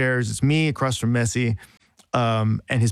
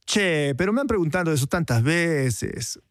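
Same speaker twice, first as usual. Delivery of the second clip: imitated Argentine Spanish, pitched higher; Argentine accent; 4 semitones higher than usual. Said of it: "I'm gonna imitate an Argentine accent".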